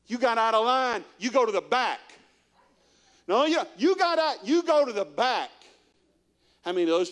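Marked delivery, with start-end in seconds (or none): 0.1-2.0 higher and louder, scolding; bossy kids. Retold or imitated. Kids telling the latecomer to go to the back, bossy. 3.3-5.5 much higher and louder; whiny bossy kid. Retold or imitated. Schoolkid sending another to the back, high whiny scold.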